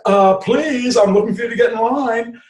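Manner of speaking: sashay the line out like a showy guard; flamboyant security guard. Shown as a demonstration. Showy guard directs a customer into line, high and theatrical. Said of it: "Or flamboyant security guard".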